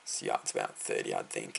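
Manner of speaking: lightly voiced low-key neighbour; neighbour's estimate. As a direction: drop into a low, quiet imitation of the neighbour. Quoting neighbour Michael's estimate.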